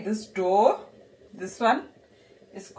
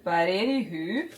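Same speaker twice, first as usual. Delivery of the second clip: ordinary delivery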